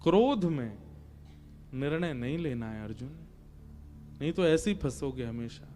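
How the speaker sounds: low, soft, measured admonition; quietly advise, leaving space between phrases; calm counsel. Acted. Krishna warns Arjun against deciding in anger, calm counsel.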